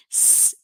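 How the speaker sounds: S as a pure sustained hiss, no vowel; isolated S sound. Shown as a demonstration. Teacher isolating the sound of S, sustained hiss. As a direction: hold a hissed S. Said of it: "é o mesmo som que a gente falou".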